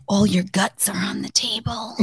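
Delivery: hushed whisper in her ear; whispered aside. Retold or imitated. Husband whispering to his wife on the table, hushed. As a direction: lean in and whisper it. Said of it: "he leans over and he's like".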